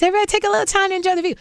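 high, light, rushed little voice; old timid-newcomer voice. Retold or imitated. Talk show newcomer showing her old voice, high and rushed. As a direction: squeak it out high and fast. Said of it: "I talked like this".

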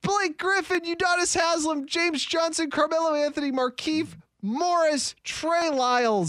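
silly high roll call, slowing at the end; put-on high voice for reading a list; dumb list-reading voice. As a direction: rattle off names in a dumb, high-pitched voice. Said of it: "reading them in your dumb voice".

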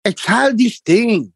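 high, slow mock scolding voice; lightly voiced older immigrant man, lecturing; mimicking the neighbour. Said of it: "and he goes".

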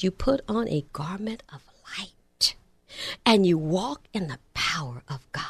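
hushed, voiceless phrase; soft, intimate whispered line. Real, not acted. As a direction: drop to a whisper to make them lean in. Preacher whispers key phrase.

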